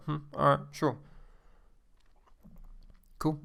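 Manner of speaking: unimpressed, sparse acknowledgement with pauses; understated and unhurried; blunt, quiet. Shown as a demonstration. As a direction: respond as if you've heard it countless times. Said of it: "that silently".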